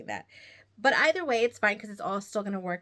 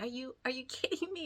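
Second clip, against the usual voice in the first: quick, higher, softer incredulous laugh; laughing disbelief; 6 semitones higher, 5 dB quieter, faster than usual. Retold or imitated. Knitter scolding herself over missed pattern line, laughing disbelief. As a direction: laugh at your own mistake in mock disbelief. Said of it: "And I was like".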